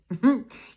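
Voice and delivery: loud burst of giggling; giggle. A narrator's reading. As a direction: giggle into the line. Jessie starts to giggle.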